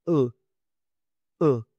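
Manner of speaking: "U" short from the throat; throat vowel demo. Shown as a demonstration. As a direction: push "u" briefly from the throat. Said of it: "El sonido viene de la garganta". ("U" corta desde la garganta)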